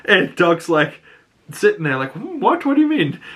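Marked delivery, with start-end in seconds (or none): 2.1-3.2 much higher, dopey "Hmm, what?" in mock confusion; dim Dunk confused. Dunk baffled by Egg's question, mock dopey voice.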